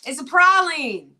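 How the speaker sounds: high, loud, drawn-out delight; gleeful echo. Real, not acted. Host echoing the local pronunciation, loud and gleeful. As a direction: belt out the new word with glee.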